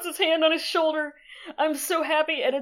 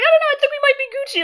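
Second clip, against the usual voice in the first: mock casual; 12 semitones higher, faster than usual. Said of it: "he's like".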